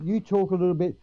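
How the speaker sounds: ordinary talk, nothing marked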